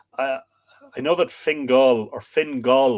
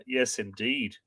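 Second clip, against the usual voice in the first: ordinary delivery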